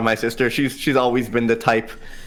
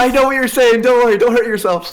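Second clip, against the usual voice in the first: higher and louder, amused; teasing laugh; 10 semitones higher, 5 dB louder than usual. Real, not acted. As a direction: reassure her while laughing. Player teasing GM through laughter.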